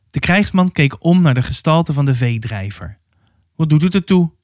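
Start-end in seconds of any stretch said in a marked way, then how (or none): none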